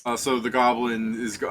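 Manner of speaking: usual speaking voice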